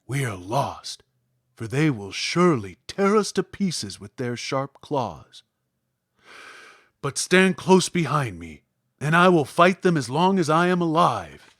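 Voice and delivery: a frightened lion steeling himself to fight; brave Lion. A narrator's reading.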